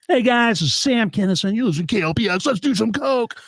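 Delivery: high-pitched comic impression; loud, strained radio station drop; Sam Kinison. Comedian recording a station ID, high yelled celebrity impression. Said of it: "it was, like, Sam Kinison".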